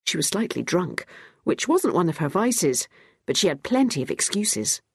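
plain reading voice

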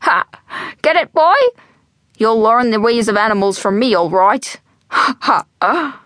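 put-on old Irish joker cackling at his own joke; wheezy laughing old man. A narrator's reading. Albert thumps Jack after his joke, wheezy laughing. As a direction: laugh and wheeze through the brogue. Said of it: "beginning to wheeze, cough, and laugh all at once".

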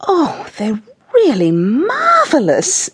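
slow, high, gushing admiration; hammed-up fawning lady; twittering. A narrator's reading. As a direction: swoop and gush over every word. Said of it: "Missus Tweedy twittering over the paintings".

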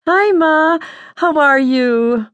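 a high, slow, fake-bright greeting; forced cheerful. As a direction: paste on a big smile and greet her. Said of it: "plastered a big old smile on my face".

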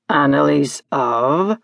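low, slow, leading question; Hagski. Acted. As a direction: prompt imperiously in a deep creature voice. Hagski prompts for full name.

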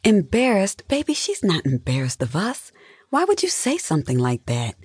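high, incredulous opening, then easing; taken aback. A narrator's reading. Wife protests husband's accusation.

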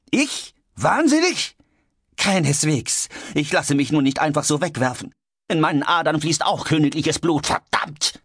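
high, affronted outburst; outraged retort ending in a curse; indignant rant. Acted. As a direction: bristle with wounded pride, pitched high. George rants back in quarrel.

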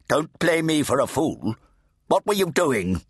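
put-on high, demanding deaf old priest; fat Redeemer. A narrator's reading. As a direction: bark at the boy to speak up.

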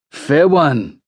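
high, delighted concession; sudden delight. A narrator's reading. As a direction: concede it with a grin. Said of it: "Momo grins with sudden delight".

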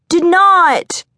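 put-on very high, loud child protest; little boy yelling. A narrator's reading. Little boy shouting back at his sister, high child yell. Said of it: "the little boy yelled back".

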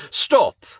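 sharp, high, loud command; commanding shout. Acted. Preacher orders the monster to stop, shouting. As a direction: halt the beast with one word. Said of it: "the preacher commanded".

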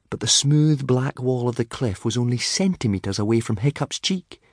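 plain reading voice

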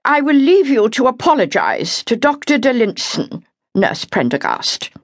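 cool reproof from a senior sister; disapproving. A narrator's reading. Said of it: "in a voice which held disapproval".

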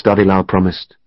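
ordinary delivery, nothing marked

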